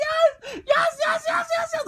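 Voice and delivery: very high, loud celebrating shouts; ecstatic yelling. Retold or imitated. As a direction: scream each one in wild joy. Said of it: "I was so fucking happy".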